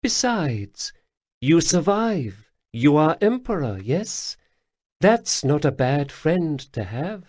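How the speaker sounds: high, purring alien lilt; Khajiit matriarch. Acted. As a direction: speak as a grateful cat-woman elder. Lesper teases the prince.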